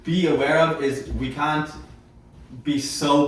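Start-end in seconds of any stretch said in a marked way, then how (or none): none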